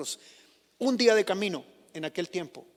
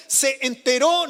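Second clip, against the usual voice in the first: "se enteró" slow, higher and louder, rising at the end; stress on se enteró; 4 semitones higher, 6 dB louder, slower than usual. Shown as a demonstration. Preacher quoting "se enteró" to the church, slow and stressed. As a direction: spell out "se enteró" slowly as a key phrase. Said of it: "la frase".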